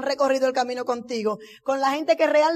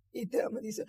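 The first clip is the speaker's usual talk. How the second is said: ordinary talk, nothing marked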